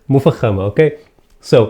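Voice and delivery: usual speaking voice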